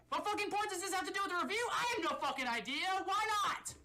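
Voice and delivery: high, fast rant; exasperated shouting. Acted. Reviewer questioning his own pointless confession, exasperated high shouting. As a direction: yell it out high, at a frantic clip.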